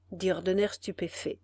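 usual speaking voice

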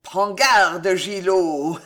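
menacing warning with a snicker; warn, then cackle nastily; sinister sneer ending in laughter. Acted. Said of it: "ricana l'oncle".

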